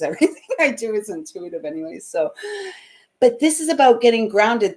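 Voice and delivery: normal talking voice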